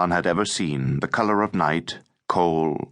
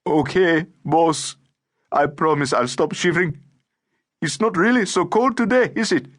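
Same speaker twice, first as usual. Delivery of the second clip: put-on high-pitched tearful boy's voice; 10 semitones higher than usual. A narrator's reading. Scolded boy promises the boss he'll stop shivering, tearful. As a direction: plead tearfully, pitched well up. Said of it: "say in a tearful voice".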